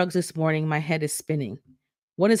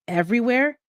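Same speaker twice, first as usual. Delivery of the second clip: higher still, rising, disbelieving; stunned; 7 semitones higher than usual. Acted. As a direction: repeat it, more shocked. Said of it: "I ask, stunned".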